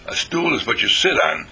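normal talking voice

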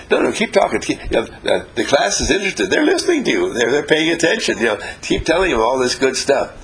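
teacher pleading to keep going, high and lively; eager teacher. Retold or imitated. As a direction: beg the speaker to continue. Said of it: "And the teacher says".

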